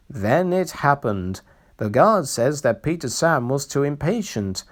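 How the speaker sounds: ordinary delivery, nothing marked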